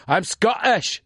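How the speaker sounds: I'm Scottish said as a Scot; light Scottish accent for learners to copy. Shown as a demonstration. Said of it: "Dilo conmigo".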